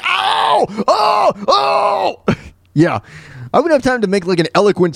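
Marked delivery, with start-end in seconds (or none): 0.0-2.3 much higher mock pained yelps; hammed-up cries of someone being stabbed; yelping ow and oh, high and comic. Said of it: "I'd probably go like".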